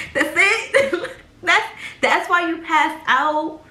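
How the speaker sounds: high, laughing disbelief; laughing mock outrage, slowing down; incredulous laughter. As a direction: scoff through a laugh. Scoffs at the girl's answer.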